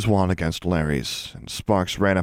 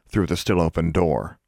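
usual speaking voice

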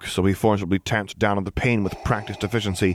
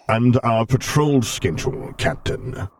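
Darth Vader voice; 5 semitones higher, slower than usual. Acted.

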